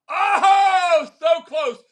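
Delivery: loud laughing oh, then so close; surprised laughing shout. Real, not acted. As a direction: react to a near miss with a laughing shout. Wheel lands near the number.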